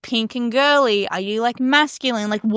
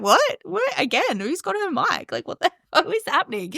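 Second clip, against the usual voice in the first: mock bewilderment, higher-pitched; baffled audience; 7 semitones higher than usual. Audience hearing an out-of-character message, baffled disbelief. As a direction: play confused listeners, laugh breaking in.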